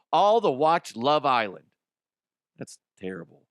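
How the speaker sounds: normal talking voice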